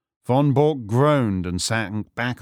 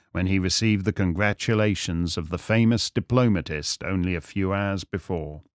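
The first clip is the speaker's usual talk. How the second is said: ordinary delivery, nothing marked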